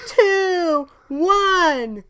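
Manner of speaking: high, loud drawn-out count; countdown shout. Pretend. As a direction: count down to a waiting crowd. Acting out the launch countdown.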